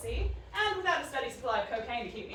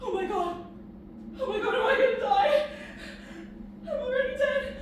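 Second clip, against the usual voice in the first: shaky panic; 11 semitones higher, 6 dB louder than usual. Acted. Dazed, wounded, on drugs.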